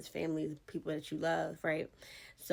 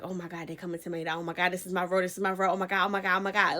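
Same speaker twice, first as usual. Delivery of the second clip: mock panic; 7 dB louder, faster than usual.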